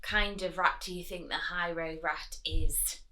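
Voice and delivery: ordinary delivery, nothing marked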